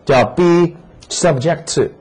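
teacher drawing attention to be subject to; slow emphatic phrase. Shown as a demonstration. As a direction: spell out be subject to word by word. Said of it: "大家看这个部分".

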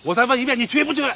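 high-pitched, insistent demand; angry ultimatum. Acted. Man giving a defiant boy one last chance, angry. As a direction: press for an answer as a last warning.

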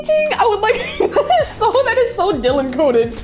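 ordinary talk, nothing marked

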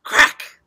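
loud, abrupt cracking word; sharp crack. A narrator's reading. Bed falls into the hole, loud crack. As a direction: hit it like breaking wood. Story sound effect read aloud.

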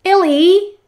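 high loud call across the water; cried out. Acted. Olga calling Ellie the crocodile, high loud cry. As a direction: shout her name to startle. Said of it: "cried Olga".

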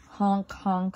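lightly voiced goose honks; goose honking. A narrator's reading. Goose makes a noise at the burrow, mild honking. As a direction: honk the words like a goose. Acting a goose honk in story.

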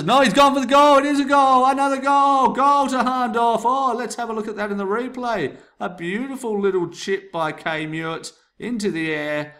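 very high, loud goal call fading into the replay; screaming goal commentary. Shown as a demonstration. Teacher testing mic levels with a shrieking goal call. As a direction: roar it like a last-minute winner. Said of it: "when I got excited there".

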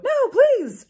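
high, loud hammed-up plea; mock begging. A narrator's reading. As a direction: beg like a melodrama heroine. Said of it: "I begged".

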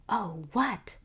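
hushed, eager curiosity; breathed eagerly. A narrator's reading. Listener hungry for the scandal, breathing it eagerly. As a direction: breathe it out, avid for the gossip. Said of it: "breathed Missus Anne Cyrilla eagerly".

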